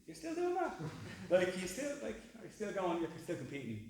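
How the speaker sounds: incredulous. Retold or imitated. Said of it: "more people are going".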